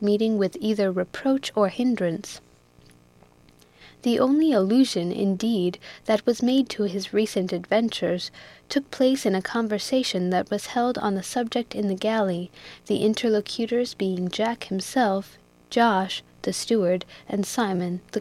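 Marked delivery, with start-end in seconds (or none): none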